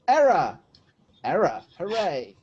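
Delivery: triumphant confirmation. Real, not acted. Charades player landing the answer, triumphant. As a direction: call out "Error" as the right answer. Guess turns out to be right.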